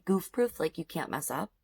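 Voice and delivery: ordinary talk, nothing marked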